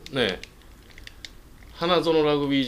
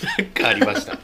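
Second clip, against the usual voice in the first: high laughing; 14 semitones higher than usual. Shown as a demonstration.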